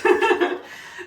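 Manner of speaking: high breathy giggle; a quick giggle at herself. Real, not acted. Laughs at leftover drizzle.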